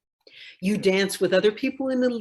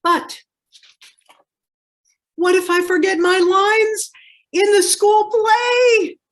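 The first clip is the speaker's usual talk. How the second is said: put-on much higher, loud wailing cry of a frightened child; 11 semitones higher, 9 dB louder than usual. Child panicking to mother about forgetting lines, loud high crying wail. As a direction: wail it out like a kid on the verge of tears, slowing at the end.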